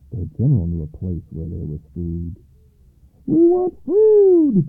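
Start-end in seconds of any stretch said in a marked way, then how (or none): 3.3-4.7 high, slow, drawn-out shout; loud demand. A narrator's reading. Hungry soldiers demanding food, slow loud shout. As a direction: demand it at full volume, pitched way up. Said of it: "demanded all the soldiers".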